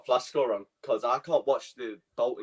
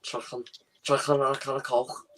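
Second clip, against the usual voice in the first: put-on Scouse accent; 3 semitones higher than usual. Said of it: "Scouse, innit?".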